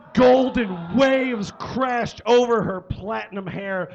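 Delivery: slow, loud, high-pitched melodrama; hammed-up dramatic narration. Reader describing butter poured over hair, hammed-up drama. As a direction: draw each phrase out grandly.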